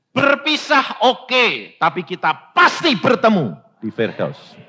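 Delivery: firm rebuke. Retold or imitated. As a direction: rebuke the crying family. Rebuking the weeping family. (menegur keluarga dengan tegas)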